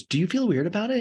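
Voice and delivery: high-pitched mock girlfriend voice; Allie. Girlfriend probing about the ex, mock curious and high. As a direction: ask it high, in imitation.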